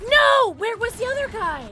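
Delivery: high, loud cry of dismay; real upset outburst; frustrated shout. Real, not acted. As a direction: yell it in disbelief. Killed by unseen player.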